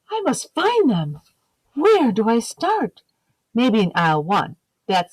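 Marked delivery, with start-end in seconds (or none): 0.0-3.0 a gasp, then a higher, eager egg voice; children's story egg, hammed-up determination; eager egg hero. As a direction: play the plucky little egg on a mission. Egg character gasps, searching.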